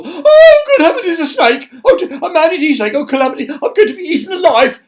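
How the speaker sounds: very high, loud panicked squeal; terrified wail. Acted. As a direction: shriek in mock terror. Terrified of the worm.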